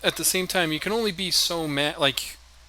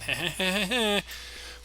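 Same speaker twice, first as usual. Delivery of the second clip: amused laugh; 4 semitones higher, 3 dB quieter than usual. Real, not acted. Laughing at his own impression.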